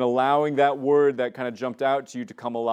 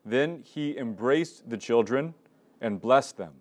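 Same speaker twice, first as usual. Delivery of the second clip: louder, slow scripture reading with long pauses; slow Gospel reading; 4 dB louder than usual. Shown as a demonstration. Leader reading the Gospel aloud for lectio divina, slow and solemn. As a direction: read each phrase deliberately, letting it sink in. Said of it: "I'm just gonna read it slowly".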